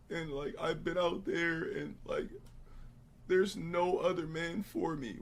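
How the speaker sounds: high, soft put-on woman; mock a girl begging her man back; halting, syrupy fake plea; pleading ex-girlfriend. Voicing an ex begging to return.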